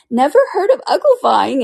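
loud, high astonished cry; exclaiming. A narrator's reading. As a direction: exclaim it in amazement. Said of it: "it exclaimed".

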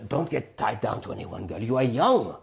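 put-on German-accented old lady, climbing in pitch; old German woman. Old woman gives life advice to young men, German accent. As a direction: imitate an elderly German woman dispensing advice. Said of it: "this German woman with a German voice".

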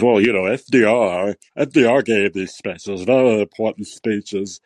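a hint of a droning historian mocked; mock Beschloss.

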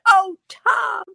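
high-pitched girlish mock swoon; gushing. Old flame imitating her dreamy wish, gushing. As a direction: gush it way up high.

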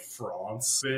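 "France" with a long ah, like "Frahnce"; mock-pretentious "France", broad open ah; affected pronunciation. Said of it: "like, f-, calling it France".

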